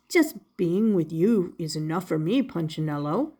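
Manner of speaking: kindly maker, voiced low; Eli the maker. Woodcarver answering his little puppet, low and kind.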